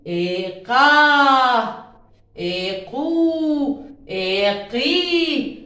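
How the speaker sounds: drawn-out slow syllables for the class; slow drill. Shown as a demonstration. Teacher reading slowly with the first graders. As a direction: stretch each syllable slowly so the kids can follow. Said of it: "اقرأ معي يا أول".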